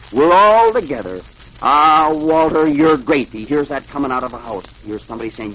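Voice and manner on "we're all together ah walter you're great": loud, slow, drawn-out admiration; loud gushing praise. A narrator's reading. Voice from a house praises Walter, loud and gushing. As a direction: stretch the words and project them.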